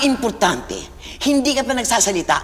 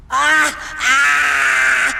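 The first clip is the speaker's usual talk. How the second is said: pained cries; 5 semitones higher than usual. Shown as a demonstration. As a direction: cry out as you get hit. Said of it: "Dapat memorable lang yung exit".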